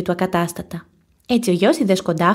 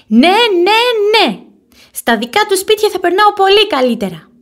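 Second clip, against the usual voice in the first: put-on stubborn child, high and loud; angry insistence; 11 semitones higher, 5 dB louder than usual. A narrator's reading. Boy insisting to his mother he wants to leave, angry. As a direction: insist angrily like a sulking kid. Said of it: "επιμένει θυμωμένος".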